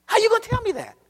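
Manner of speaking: high, loud indignant challenge; climbing preacher's cry; preaching shout. Real, not acted. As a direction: hurl the question at them.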